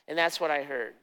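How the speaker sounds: ordinary talk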